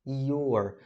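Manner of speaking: "your" stretched into I, O and R; teacher modeling a drawn-out "your". Shown as a demonstration. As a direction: drag out "your", every sound audible. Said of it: "eu gosto de puxar assim".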